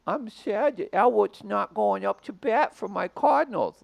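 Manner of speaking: high, slow whiny fan; mock sad fan voice. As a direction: whimper it like a sulking fan. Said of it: "Is that a good voice".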